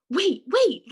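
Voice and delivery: high, loud "wait, wait"; urgent interruption. Acted. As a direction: call out urgently over a crowd. Bat pushes through crowd.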